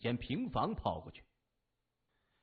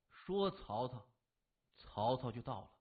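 plain reading voice

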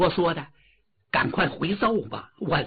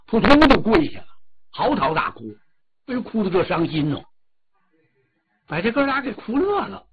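ordinary delivery, nothing marked

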